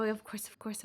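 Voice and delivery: ordinary talk, nothing marked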